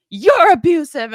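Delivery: high, loud angry retort; going off. Retold or imitated. Ex turning the accusation back on her, going off. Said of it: "just starts going off on me".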